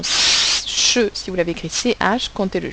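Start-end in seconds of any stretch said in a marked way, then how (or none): 0.0-1.1 ch drawn out as "shhh-euh"; isolated sound. Shown as a demonstration. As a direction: hold the hush of ch, then release it. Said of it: "le son ch".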